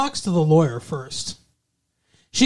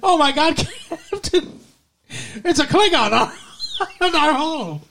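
panicked crewman; 9 semitones higher than usual. Pretend. Playing a panicked starship crewman.

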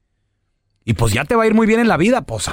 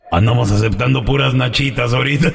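raspy devil; 6 semitones lower than usual. Acted. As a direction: drag it out in a demonic rasp. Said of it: "Qué voz tan rasposa".

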